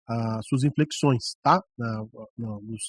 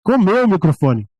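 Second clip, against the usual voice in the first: loud, mouth right on the mic; eating the microphone; 3 semitones higher, 8 dB louder than usual. Shown as a demonstration. Teacher showing preachers who crowd the mic, loud and too close. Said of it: "Tem gente que também quer".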